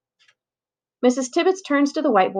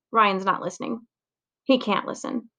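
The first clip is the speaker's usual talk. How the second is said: ordinary talk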